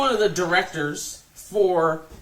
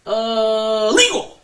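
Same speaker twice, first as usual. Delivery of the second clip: a drawn-out "Uh", then "legal." yelled high and loud; mock umpire barking the call mid-swing; umpire's late call; 4 semitones higher, 7 dB louder, much slower than usual. Retelling the ump who called it too late, high loud mock yell. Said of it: "the umpire goes".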